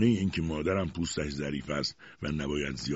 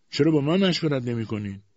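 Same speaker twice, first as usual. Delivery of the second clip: raised, higher voice, patience gone; exasperated outburst; 7 semitones higher, 4 dB louder than usual. A narrator's reading. Mother, left out of household plans, exasperated outburst. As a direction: blurt it out in protest. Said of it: "طاقتش تمام شد و بی مقدمه گفت".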